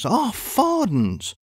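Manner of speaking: high, slow indignant cry of half fardens. A narrator's reading. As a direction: drag it out in mock outrage. Indignant exclamation, shout.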